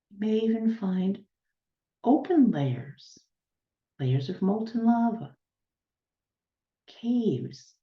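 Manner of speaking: unhurried guiding voice, long silences between instructions; guided meditation. Real, not acted. Healer guiding listeners to ground down to the planet's center, guided meditation. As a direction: lead them step by step, letting each image settle.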